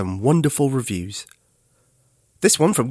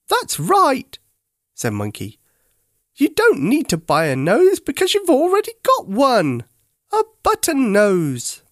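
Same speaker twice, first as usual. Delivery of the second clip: high, louder curious monkey; Monkey's voice; 11 semitones higher, 3 dB louder than usual. A narrator's reading. Monkey asking Teddy what is wrong, high and curious. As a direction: pipe up like a friendly monkey.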